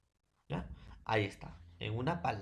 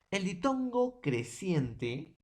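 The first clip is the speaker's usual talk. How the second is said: slow, higher title announcement; 7 semitones higher, much slower than usual. Shown as a demonstration. Teacher announcing the new topic to students, slow and higher. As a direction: announce the topic slowly and clearly. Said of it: "introduces new topic slowly".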